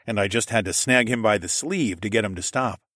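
ordinary delivery, nothing marked

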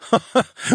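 brief high-pitched scoffing laugh; scornful laugh. A narrator's reading. Captor mocks the sage's praise, laughing. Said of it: "I laughed".

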